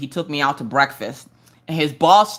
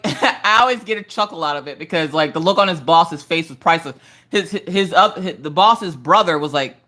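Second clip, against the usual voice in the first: ordinary talk, nothing marked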